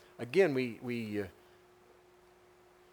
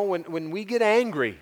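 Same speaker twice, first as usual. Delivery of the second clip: ordinary talk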